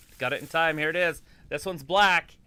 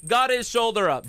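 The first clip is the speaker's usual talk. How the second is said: excited; 8 semitones higher, 8 dB louder, slower than usual. Real, not acted. Excited wrestling call.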